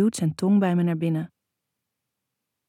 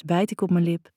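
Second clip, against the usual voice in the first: ordinary delivery, nothing marked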